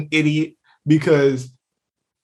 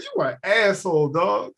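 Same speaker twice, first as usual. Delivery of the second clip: ordinary talk, nothing marked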